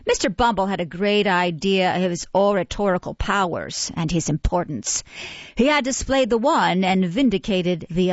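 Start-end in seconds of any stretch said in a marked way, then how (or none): none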